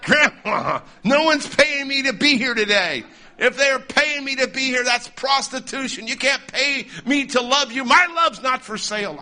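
high, loud, hammed-up showman's comeback; mock heckler put-down. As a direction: play at shouting down Grandma with stock lines. Imagined heckler comebacks from youth assemblies.

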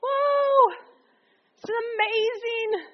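a high, amazed whoa trailing into wonder. Retold or imitated. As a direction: gasp at it like seeing a miracle. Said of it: "They're like".

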